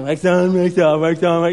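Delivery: half-hearted, run-together chanting; Daniel. Retold or imitated. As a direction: go through the motions carelessly. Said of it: "And Daniel's like".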